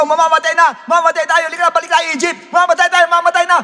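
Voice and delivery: high, loud cries of terror; preacher voicing the Israelites screaming in panic; panicked screaming crowd. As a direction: scream like a panicking crowd sure they will die.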